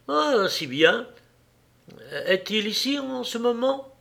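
obliging landlady. A narrator's reading. Voices the landlady and Armand's exchange.

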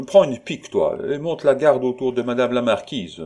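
loud, high, jovial command; mock military order. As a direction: give a comic colonel's order. Colonel jokingly orders his valet.

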